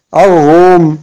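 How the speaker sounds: Al-rum with no l, long r; doubled r. Shown as a demonstration. Teacher repeating the correct reading, "Al-rum" with doubled r. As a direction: merge the l of "Al-rum" into the r. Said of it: "on ne dit pas al-rum".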